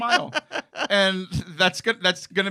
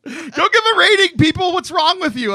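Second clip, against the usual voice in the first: exasperated shout; 9 semitones higher, 6 dB louder than usual. Real, not acted. Scolding listeners, loud.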